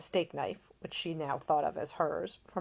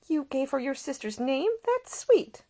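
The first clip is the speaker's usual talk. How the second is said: higher, brighter-pitched caring lady; a young woman tending a seasick man, solicitous and higher; Ida, gentle young woman; 10 semitones higher than usual. A narrator's reading. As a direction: voice the attentive young lady nursing him. Ida speaking.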